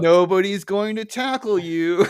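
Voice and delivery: slow, loud coaxing; coaxing the dog, slow and loud; Homer impression. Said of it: "He's like".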